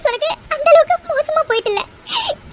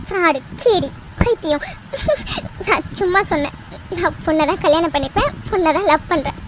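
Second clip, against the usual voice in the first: teasing, softer; 8 semitones lower, 5 dB quieter than usual. Acted. Wife teasing after turning tables.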